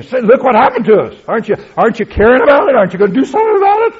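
very high, loud, racing complaint; emotional outcry. Retold or imitated. Slain souls protesting to God, high, loud and fast. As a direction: squeal it out fast in hurt disbelief. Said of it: "But this is emotional".

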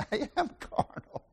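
breathy laugh through the words; laughing admission. Retold or imitated. Pastor admitting fault to his wife, laughing it out. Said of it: "I backed up and I said".

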